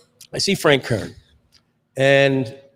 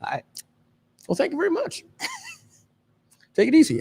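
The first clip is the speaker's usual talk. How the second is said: much higher, breezy brush-off; Frank Kern imitation; faster than usual. Frank Kern brushing off the starstruck guest, high mock voice. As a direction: wave the fan away in a high, airy voice. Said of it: "And he goes".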